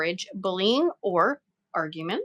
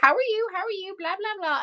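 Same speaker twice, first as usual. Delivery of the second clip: high, singsong fake greeting; mock chirpy small talk; 8 semitones higher, faster than usual. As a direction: breeze through it sweetly.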